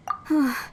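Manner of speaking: worried sigh. Acted. Margaret worried about her cat.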